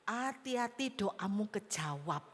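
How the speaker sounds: ordinary talk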